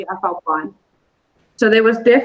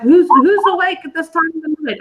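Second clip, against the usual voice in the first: raised, high call down the dark passage; calling out loud; 8 semitones higher, 4 dB louder than usual. Retold or imitated. Half-asleep night call to an unseen walker, loud. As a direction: call out to whoever is there. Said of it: "I said it out loud".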